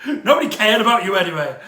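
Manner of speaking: loud, laughing through the words; laughing dismissal. Real, not acted. Laughs dismissing dead character.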